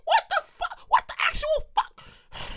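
squeaky high, hushed incredulity; stunned disbelief. Retold or imitated. Acted disbelief at the turkey line.

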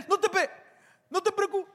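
high, broken-off stammer; mock nervous, unfinished attempts; faltering reassurance. Tomb reassuring while faltering.

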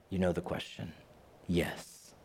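low, soft ominous voice; hushed and knowing, like death. Retold or imitated.

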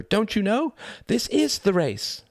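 high, exuberant badger cry; exclaiming. A narrator's reading. Badger revealing the truth to Mouse, high exclamation. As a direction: proclaim it high, drawing out the ending. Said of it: "cried Mister Badger".

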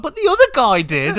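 high, loud mock whine of complaint; protesting. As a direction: protest it loudly like it's unfair. Retold protest to the barman.

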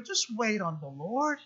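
"Just wait on the Lord" said low and very soft, almost hushed. Retold or imitated. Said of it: "but He's saying".

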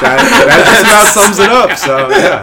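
loud, high laughing speech; helpless laughter, pitch shooting up; cracking up. Real, not acted. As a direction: get the words out through real laughter. Cracking up at deadpan joke.

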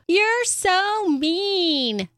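high, very drawn-out mock whine; hammed-up kid protest; wailing daughter. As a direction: imitate a sulky child, stretching every word. Daughter's protest imitated by mom.

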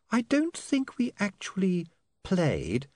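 put-on high, hesitant young lady; lightly voiced upper-class girl; Saccharissa voice. A narrator's reading. Saccharissa voice again.

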